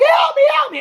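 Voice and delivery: very high squealed plea; mock cry for help. Gagged captive gets free, mock squeal for help. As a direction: imitate a frantic captive, pitch shooting up.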